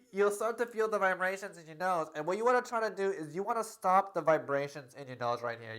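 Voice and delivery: normal talking voice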